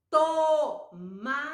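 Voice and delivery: Tomate in three long, separate syllables. Shown as a demonstration. Teacher showing a word with to, syllable by syllable. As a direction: sound out Tomate slowly, to, ma, te. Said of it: "Olha só".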